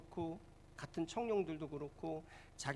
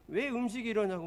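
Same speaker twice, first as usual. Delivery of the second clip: raised, louder demand about the food; angry complaint; 6 semitones higher, 6 dB louder than usual. Retold or imitated. Young pastor complaining to restaurant owner, angry and loud. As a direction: snap at the owner, higher and louder. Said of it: "잔뜩 화가 나가지고".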